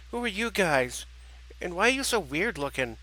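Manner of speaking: put-on high child's questioning voice; curious little girl. Little girl beside her father questioning strangers, curious.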